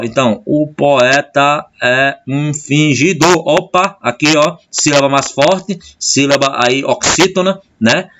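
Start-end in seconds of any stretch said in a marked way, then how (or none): 0.4-3.5 "O poeta é um fingidor" slowly, beat by beat, with "poé" as one beat; measured beats on "O poeta é um fingidor", stopping on the final "dor"; poetic syllable count. Shown as a demonstration. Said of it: "conta como uma sílaba só".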